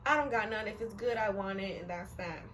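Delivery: usual speaking voice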